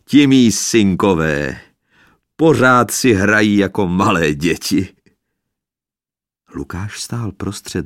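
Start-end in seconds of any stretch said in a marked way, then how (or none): 0.1-4.9 laughing through words. A narrator's reading. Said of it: "smích, že skoro dusil zajíkavá slova".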